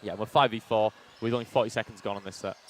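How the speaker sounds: ordinary talk, nothing marked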